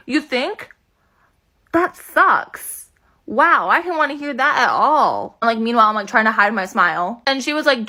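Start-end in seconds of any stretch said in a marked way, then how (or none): 0.1-5.4 hammed-up slow dismay, hiding glee; mock disappointment. Kid to mom, faking dismay over a maybe-broken finger, hammed-up. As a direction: play it fake-sad while secretly thrilled.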